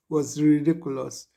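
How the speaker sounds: ordinary delivery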